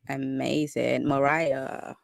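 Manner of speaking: ordinary delivery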